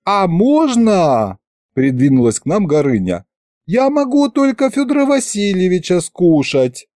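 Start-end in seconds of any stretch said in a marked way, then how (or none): 0.0-1.4 eager dragon head. Acted. 3.7-6.9 dragon head voice. Acted. Dragon head offers to eat.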